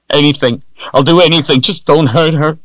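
high, loud, pleading; desperate begging. Acted. Richard begging Darken Rahl to spare Kalen, loud high plea.

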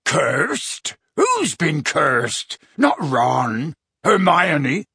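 a hint of Hagrid, worried questions; alarmed Hagrid. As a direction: Hagrid anxiously asking who was hurt.

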